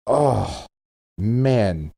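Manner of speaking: normal talking voice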